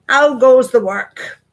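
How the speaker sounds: loud slow bossy call; farmer calling out. Acted. Lazy farmer calling from his bed, loud and slow. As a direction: bellow it out like a lazy boss.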